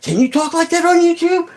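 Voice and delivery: very high-pitched mock dad voice; squeaky impression of a disapproving father; imitated dad. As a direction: mimic a scolding dad in a high, strained pitch. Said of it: "my dad's all like".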